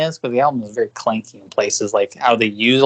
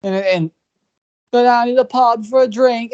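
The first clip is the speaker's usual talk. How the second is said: yelling English singer; 10 semitones higher than usual. Retold or imitated. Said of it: "him just going".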